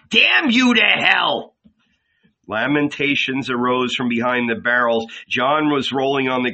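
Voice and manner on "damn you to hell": slow, high-pitched, angry damnation; hurled curse. A narrator's reading. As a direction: fling the curse out word by word. Simon hurls the curse for writing.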